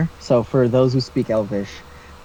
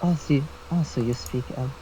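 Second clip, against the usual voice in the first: quiet in-character question; 7 dB quieter, much faster than usual. Acted. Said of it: "and in Elvish".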